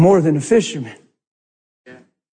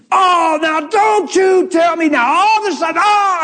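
indignant outburst; 12 semitones higher than usual. Retold or imitated. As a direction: ham up a man losing his temper. Stressed friend bursting out.

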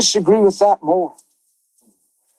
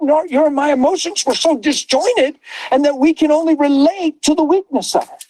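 high, driving preacher's cry with shouted peaks; preaching at full force; 7 semitones higher than usual. Acted. Preacher driving the point home to listeners, fervent and raised. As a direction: lift the room like a pulpit.

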